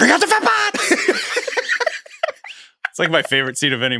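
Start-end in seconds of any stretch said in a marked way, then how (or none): 0.0-0.9 high squealing movie line; Austin Powers impression. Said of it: "in Austin Powers where all of a sudden she goes". 1.0-2.0 breathy high laugh. Real, not acted. Laughing after the movie quote.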